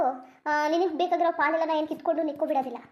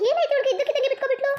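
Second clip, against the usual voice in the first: much higher, louder, fast and laughing; surprised laugh; 10 semitones higher, 5 dB louder, faster than usual. Acted. Mother laughs in surprise that Nandini suddenly gave up. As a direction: burst out in amused disbelief.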